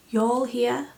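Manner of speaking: put-on light Yorkshire accent. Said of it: "saying that in a Yorkshire accent".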